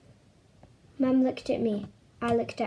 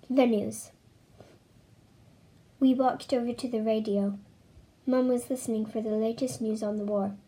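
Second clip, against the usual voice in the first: ordinary delivery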